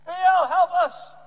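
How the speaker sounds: loud, high drawn-out plea; acted cry for help to a god; calling out. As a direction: stretch it out and call high. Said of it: "they called out to Baal".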